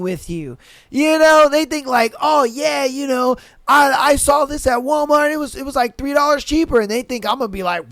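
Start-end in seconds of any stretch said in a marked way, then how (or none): 2.2-6.8 smug customer. Retold or imitated. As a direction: brag like a bargain hunter. Mimicking customer bragging.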